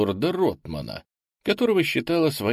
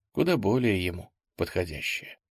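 plain reading voice

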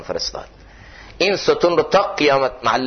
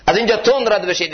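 much higher urgent repeat of تند رد بشید; higher, urgent call to move fast; urgent command; 9 semitones higher, faster than usual. Retold or imitated. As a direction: press them to hurry past, pitch jumping up. Prophet urging companions to hurry.

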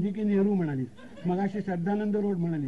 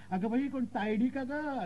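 mock excited cry; 6 semitones higher, slower than usual. Wife spotting someone from her maiden home, mock high cry. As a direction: imitate a delighted high exclamation. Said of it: "ही एकदम ओरडली".

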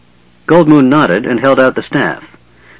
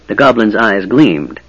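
ordinary delivery

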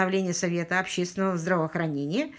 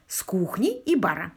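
ordinary delivery

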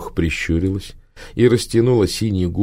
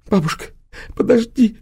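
pleading in pain. Acted. Victoria begs in pain.

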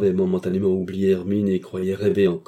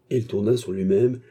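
ordinary talk

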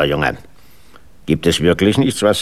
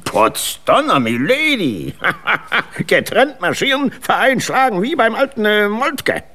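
much higher, laughing, rousing battle cry; rally the troops with boyish glee; excited old major; 10 semitones higher than usual. Acted. Begeisterter alter Major. (begeistert und hoch, mit Lachen)

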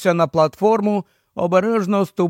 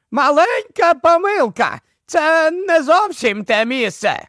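very high-pitched professor's cry; calling over surf; 10 semitones higher than usual. Acted. Professor excuses his mistake over the surf, high-pitched call.

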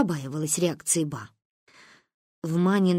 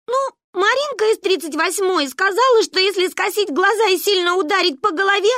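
high, loud whining explanation; tearful explaining; 12 semitones higher, 5 dB louder than usual. A narrator's reading. Child explaining the experiment to mother, tearful. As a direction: wail out the explanation.

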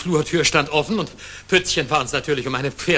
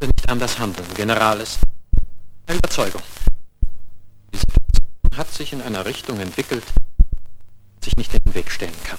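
louder, forceful argument; firm raised insistence; heated insistence; 4 semitones lower, 7 dB louder than usual. Acted. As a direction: press the point hard. Heated political confrontation.

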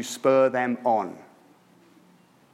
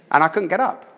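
ordinary talk, nothing marked

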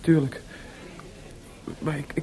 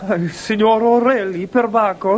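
hammed-up Italian accent, slow and louder; mock Italian; 5 semitones higher, 5 dB louder, slower than usual. Mozart mocking the Italian opera boss, hammed-up Italian accent. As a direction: play the pompous Italian signor for a laugh.

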